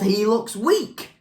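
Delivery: grumbling. A narrator's reading. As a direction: grumble slowly, pitch rising on the last word. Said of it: "And she grumbled".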